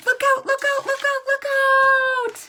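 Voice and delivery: very high, loud cry of look out; high-pitched panicked calls; alarmed warning. Acted. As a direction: shout a warning to the riders. Sleigh about to crash.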